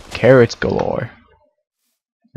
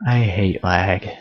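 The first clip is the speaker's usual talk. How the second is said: ordinary talk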